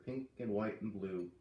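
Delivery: plain reading voice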